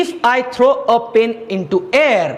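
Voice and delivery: very slow, careful reading; listen carefully, read it word by word; slow dictation. Shown as a demonstration. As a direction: dictate it slowly for the class. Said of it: "वाक्य ऐका व्यवस्थित".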